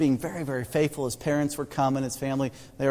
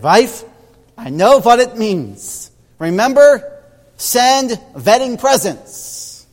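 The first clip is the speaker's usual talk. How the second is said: put-on triumphant immigrant husband, Central European accent, loud and much higher; thick foreign accent; 8 semitones higher, 11 dB louder, much slower than usual. Husband proudly solving the puzzle for his wife, thick Eastern European accent. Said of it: "the husband said in his thick accent".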